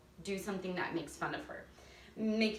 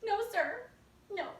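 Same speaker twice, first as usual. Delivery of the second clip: put-on sassy silly girl; 12 semitones higher than usual. Shown as a demonstration. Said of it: "hands on her hips".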